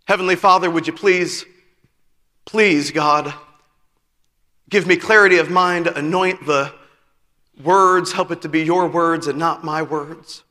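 low, soft prayer voice; hushed pastor's prayer; quiet prayer. Real, not acted. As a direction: pray quietly before the sermon. Opening prayer.

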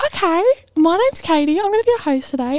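party host greeting. Retold or imitated. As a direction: put on a party host's welcome voice. Party host greets arriving customers.

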